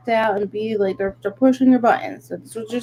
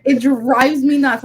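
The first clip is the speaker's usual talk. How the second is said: ordinary talk, nothing marked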